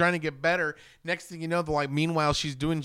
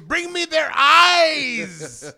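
slow, high, loud villain decree; hammed-up sinister order; mock villain command; 10 semitones higher, 7 dB louder, much slower than usual. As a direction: boom it out like a cult leader. Quoting villain line, laughing.

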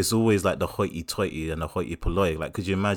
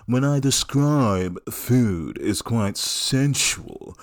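a languid, velvety wine-snob drawl, taking his time; Lloyd Grossman; slower than usual. Retold or imitated. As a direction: savour every word like a smooth TV connoisseur. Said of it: "And his whole thing was like, "He used to talk like this".

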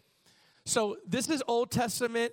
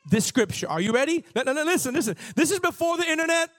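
high and loud, rising excitement; excited preaching; 8 semitones higher, 5 dB louder, faster than usual. Acted. Preacher hyping the church before reading Jude, excited and loud. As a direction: build them up for the reveal. Builds excitement over scripture.

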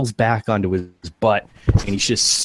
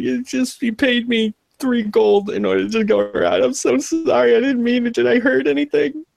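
very high, pleading whimper; frightened apologizing. Acted. Scared worker apologizes to the intruder for the job, panicked.

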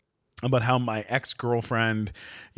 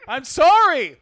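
loud, high mock contrition; shouted apology; 14 semitones higher, 8 dB louder, slower than usual. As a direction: yell sorry in playful desperation.

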